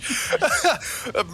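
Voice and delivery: helpless giggle; breaking up laughing; high giggle. Real, not acted. Still laughing at the bit.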